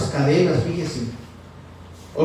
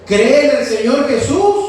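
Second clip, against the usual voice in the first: loud, higher-pitched quote of scripture; ringing preacher's declaration; emphatic proclamation; 5 semitones higher, 7 dB louder than usual. Retold or imitated. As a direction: proclaim it loudly, pitch lifted. Proclaims the true answer from scripture.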